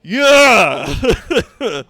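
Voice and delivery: a loud, high yeah through laughter; laughing yeah. Real, not acted. Laughing at her own rant with chat, loud laughing yeah. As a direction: crack up at your own rant.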